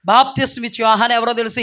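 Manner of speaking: ordinary talk, nothing marked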